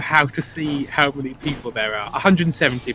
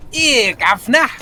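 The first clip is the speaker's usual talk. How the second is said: exaggerated Cockney, higher and louder; Cockney impression; 7 semitones higher, 6 dB louder, slower than usual. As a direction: bark it like a cartoon London street trader. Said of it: "Cockney accent is like".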